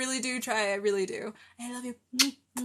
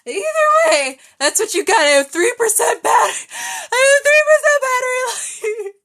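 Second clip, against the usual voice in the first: high, very loud excited; excited shout; 9 semitones higher, 15 dB louder, faster than usual. Real, not acted. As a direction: blurt it out loud and wound up. Excited after singing, shouting.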